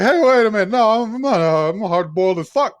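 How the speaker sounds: high, loud, offended denial; squawking, wounded pride; indignant protest. Retold or imitated. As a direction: bristle at being called naive. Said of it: "he's just like".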